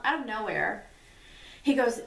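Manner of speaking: ordinary talk, nothing marked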